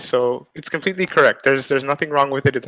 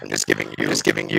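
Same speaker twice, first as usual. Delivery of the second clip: glitchy robotic feedback voice; faster than usual. Real, not acted. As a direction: voice breaking into robotic echo. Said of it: "are we hearing a robot voice here".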